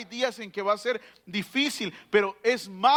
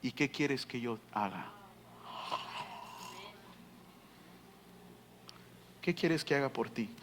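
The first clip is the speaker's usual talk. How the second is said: lower, softer royal question; the king asking; 9 semitones lower, 14 dB quieter than usual. Acted. King asking Nehemiah what he wants, lower and softer. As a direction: ask gently as a king would. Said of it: "El rey le dice".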